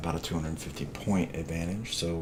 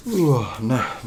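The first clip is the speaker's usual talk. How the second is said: words stretched by a yawn; 6 semitones higher, 6 dB louder, slower than usual. Real, not acted. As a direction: talk through a big yawn. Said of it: "not sure why I'm yawning right now".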